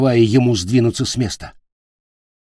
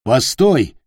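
louder, urgent call; 3 semitones higher, 5 dB louder than usual. A narrator's reading. The captain halts Питер's attack, calling out. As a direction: call out to halt him. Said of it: "крикнул капитан".

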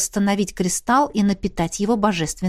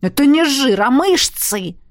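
higher, louder protest; indignant; 6 semitones higher, 5 dB louder than usual. A narrator's reading. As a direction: protest in wounded pride. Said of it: "возмутился бедолага". (возмущённо)

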